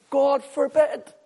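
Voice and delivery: slow, high, loud refusal; emphatic outcry. Acted. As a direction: thunder it out, drawing out each word. Preacher voices Paul's emphatic answer.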